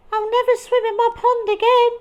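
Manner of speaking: high, fast tearful whine; sniffling. Acted. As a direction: sniff it out miserably. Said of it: "sniffed Frog".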